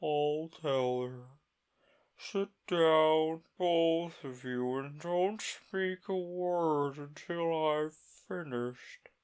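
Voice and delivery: slow, high, mournful turtle voice; a weepy old turtle settling in to tell his tale; mock turtle voice. The Mock Turtle to Alice, slow and high.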